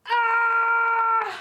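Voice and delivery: high, loud shocked yell; cartoon gasp-scream of shock. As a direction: cry out like the startled figure. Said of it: "il est en mode".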